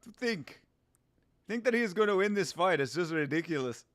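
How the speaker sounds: lightly put-on South African accent; scoffing champ, South African accent. Streamer as champ dismissing the challenger, South African accent. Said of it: "I like that South African accent".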